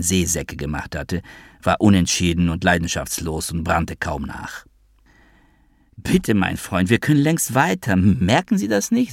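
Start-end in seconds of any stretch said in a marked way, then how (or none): 6.0-9.1 higher, hammed-up whiny crybaby; mock crybaby voice. Noah fakes a crybaby whine to the taxi driver. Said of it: "mit gespielter Heulsusens Stimme".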